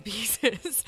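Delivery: ordinary talk, nothing marked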